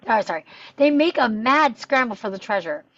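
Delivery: ordinary talk, nothing marked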